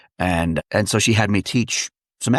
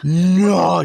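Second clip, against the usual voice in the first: high, dismayed Scottish cry; imitated motherly dismay, pitched high; 9 semitones higher, 3 dB louder than usual. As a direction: react aghast as a wee Scottish mum. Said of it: "She's like".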